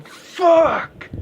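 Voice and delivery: high, loud mock yell; Lars yelling. Lars yelling at James while he sits there, mock yell. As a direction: explode with it like a spoiled kid. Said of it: "while Lars is going".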